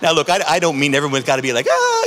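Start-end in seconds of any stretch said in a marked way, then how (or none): 1.7-2.1 a sudden, much higher, giddy "Ah"; mock giddy squeal of joy; giddy exclamation. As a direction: burst out like a giddy churchgoer. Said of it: "you know, giddy".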